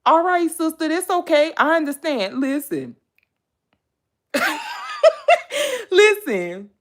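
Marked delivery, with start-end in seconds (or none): 4.3-5.8 real high laughter burst; laughing at her own rant; loud high laugh. Real, not acted. Laughing at herself.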